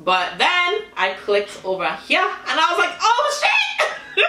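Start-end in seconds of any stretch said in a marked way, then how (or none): 3.0-4.2 high, startled squeal into a laugh; shocked. Real, not acted. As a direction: gasp it out in shock, then laugh. Shocked at the costume photo.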